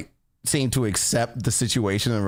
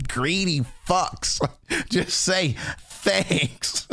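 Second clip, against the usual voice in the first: laughing tease; 6 semitones higher, much slower than usual. Acted. Laughing at chat's requests.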